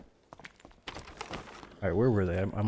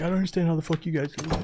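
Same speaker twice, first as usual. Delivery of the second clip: higher mock rant, pitched well up; imitating a raging buddy; 8 semitones higher, faster than usual. Streamer imagining his buddy raging at teammates, higher mock rant. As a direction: squeak out the buddy's disbelief. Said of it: "He'd be like".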